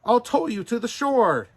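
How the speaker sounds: high, loud eager promise; urgent. Acted. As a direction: call it out over the water. Pinocchio urges father.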